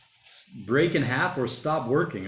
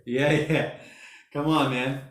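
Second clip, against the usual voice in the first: ordinary talk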